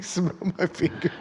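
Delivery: slow creepy whisper. Retold or imitated. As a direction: lure him in softly, slowly. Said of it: "He's like".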